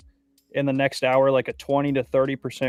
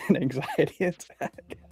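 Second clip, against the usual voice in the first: laughing; 6 semitones higher than usual. Real, not acted. Laughing while repeating partner's words.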